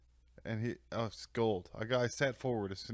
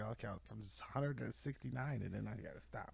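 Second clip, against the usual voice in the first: much softer groggy voice; drowsy mumble; 8 dB quieter than usual. Retold or imitated. Drugged patient protesting to the nurse, groggy. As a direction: murmur it half asleep. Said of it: "He's like".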